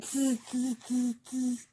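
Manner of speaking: hissy little "tz" monkey sounds; monkey chatter. A narrator's reading. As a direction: mimic mocking monkeys, tz by tz. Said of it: "monkeys only shook their fingers back at him and said".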